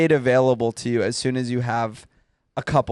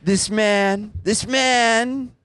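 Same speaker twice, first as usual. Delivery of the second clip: higher, lightly voiced Trump; a hint of Trump, pitched up; Trump impression; 8 semitones higher, much slower than usual. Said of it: "I can't do Trump".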